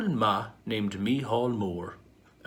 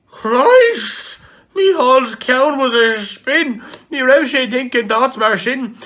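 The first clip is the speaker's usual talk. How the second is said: much higher, much louder panicked cry; shocked outcry; 11 semitones higher, 11 dB louder than usual. Acted. Micheál reacts to Sue's offer, shocked loud outcry. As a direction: yell it out in horror.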